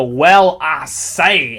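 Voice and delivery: a Southern "Well, I say" with a loud "Well"; Southern accent demo. Shown as a demonstration. GM shows players how to start the Southern accent. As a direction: kick off the Southern drawl with "Well". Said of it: "the easiest way to get into it".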